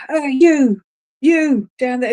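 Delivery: lightly voiced gruff landlord calling out; gravelly pub landlord. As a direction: call out like a pub landlord, mildly hammed-up. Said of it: "I feel like I've got a gravelly voice".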